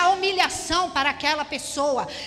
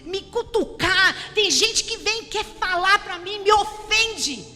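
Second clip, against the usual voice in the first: raised, forceful preacher voice; full-force preaching; 6 semitones higher, 5 dB louder than usual. Acted. Preacher urging the church not to answer offences, full force. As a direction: drive it home from the pulpit.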